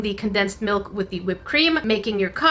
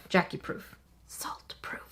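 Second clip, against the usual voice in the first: hushed, voiceless add-on; playful whisper; whispered aside; 14 dB quieter than usual. Real, not acted. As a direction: slip it in under your breath. Whispered joking asides to self.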